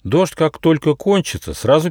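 playfully mysterious; enigmatic. Acted. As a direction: answer like it's a riddle. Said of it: "загадочно ответил Нюфа".

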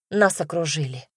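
ordinary talk

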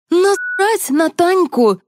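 very high, drawn-out defiant yell. A narrator's reading. Lizka dismissing the missing star, yelling. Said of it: "заорала Лизка".

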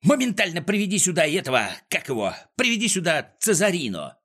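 high, hurried order to a servant; impatient boss. Acted. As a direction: rattle off the command, groping for the name. Klops urgently orders his servant.

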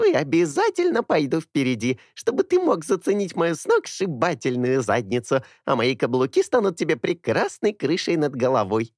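squeaky mimicking of a girl; squeaky mocking voice. A narrator's reading. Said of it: "раздался писклявый голос". (писклявый передразнивающий голос)

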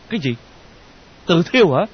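high, loud startled question; taken aback and alarmed; shocked. Acted. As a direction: ask in horrified disbelief. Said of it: "Đường nghe nói thất kinh".

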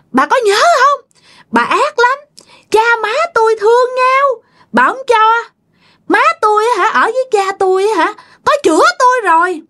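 long, high-pitched, bitter accusation; shrill spirit voice. Acted. Murdered grandchild's spirit recounts the crime to grandmother, shrill. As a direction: rail at her in a piercing possessed voice. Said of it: "bà nói với cái giọng the thé nha".

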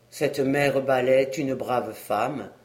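lightly voiced gentle man, softened; touched. A narrator's reading. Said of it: "répondit l'homme attendri".

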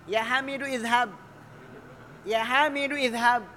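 "Hamidu" followed by a fully sounded i in "izhab"; high, loud "Hamidu-izhab" with both vowels; wrongly joined. Shown as a demonstration. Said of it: "Tapi kalau mau disambung".

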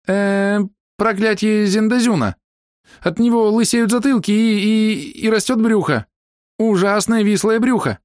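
high-pitched improvised threat; hesitant high female warning; high-voiced woman. A narrator's reading. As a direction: make up a curse, high and halting. Hidden woman invents a curse.

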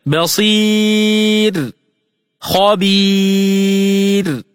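slow, drawn-out chanted recitation of each word; Quranic recitation. Shown as a demonstration. Teacher chanting examples for students in slow recitation. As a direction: intone the words like tajweed recitation. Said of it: "مثال ذلك".

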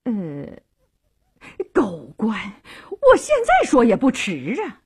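falter, then lash out with rising pitch; acted noblewoman caught out, still cursing; belated retort. A narrator's reading. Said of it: "半天才说".

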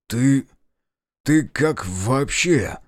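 halting, slow and dumbfounded; stunned mumbling. A narrator's reading. Narrator to his brother back from the dead, halting mumble. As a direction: stumble over it, lost for words. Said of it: "промямлил я какую-то чушь".

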